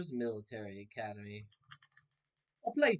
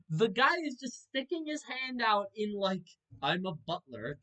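louder, higher incredulous rant; exasperated outrage; 8 semitones higher, 7 dB louder than usual. Real, not acted. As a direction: rant at the screen in disbelief. Outraged at game scene.

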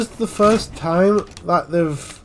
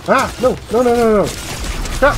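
panicked yelling; 4 semitones higher than usual. Real, not acted. Player caught out in the game, panicked yelling. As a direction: yell out in rapid, rising alarm.

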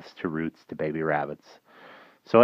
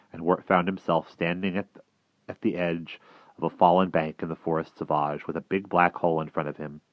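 plain reading voice

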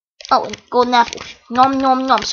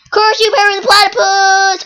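hammed-up villain shout, a bit higher and louder; cartoon villain curse; 5 semitones higher, 5 dB louder than usual. Gamer losing a fight, mock villain curse. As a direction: shake a fist like a defeated cartoon villain.

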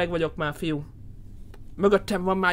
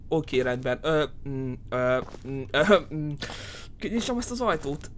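ordinary talk, nothing marked

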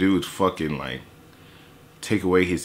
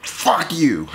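mock angry commenter; 9 semitones higher than usual. Imitating angry comment section.

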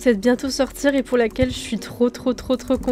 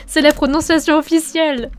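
louder, laughing indignation; mock indignant protest; 4 semitones higher, 5 dB louder than usual. Real, not acted. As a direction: protest playfully, punched out loud. Mock protest at viewer's correction.

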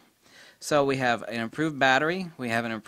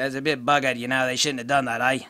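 put-on Australian accent, a bit louder; mock Australian; 6 dB louder than usual. Reviewer mimicking how Australians complain, mock Australian accent. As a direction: imitate an Australian, badly and broadly. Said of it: "what does the Australian say".

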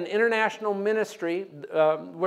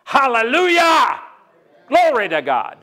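hallelujah shout; 6 semitones higher, 6 dB louder than usual. Real, not acted. Preacher erupts in praise before the congregation, shouting. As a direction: shout it to the rafters. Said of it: "preacher shouts praise".

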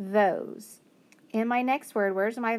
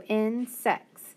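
insects said very slowly; slow blending. Shown as a demonstration. Teacher to students, slowly blending insects. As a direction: draw insects out syllable by syllable. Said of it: "So s-, ex, sex".

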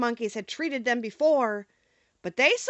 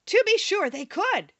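higher, mildly excited exclamation; crying out; 7 semitones higher, 4 dB louder than usual. A narrator's reading. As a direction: exclaim as the Scarecrow with sudden eagerness. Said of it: "cried the Scarecrow".

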